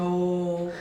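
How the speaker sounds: drawn-out mock hoot; silly classroom noise. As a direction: imitate students droning "oh" to pester teacher. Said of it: "उल्टी सीधी आवाज़ें". (छात्रों जैसी लंबी शरारती आवाज़)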